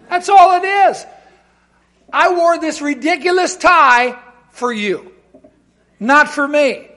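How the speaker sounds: loud, high, fervent sermon climax; impassioned preacher; full-force preaching. Acted. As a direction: drive it home to the congregation.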